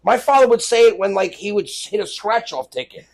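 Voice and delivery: ordinary talk, nothing marked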